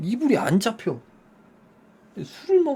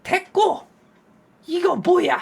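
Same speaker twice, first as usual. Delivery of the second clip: high, sharp angry demand; angry wife; 10 semitones higher, slower than usual. Retold or imitated. Wife confronting husband about the bill, high angry demand. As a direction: cut him off and demand an answer.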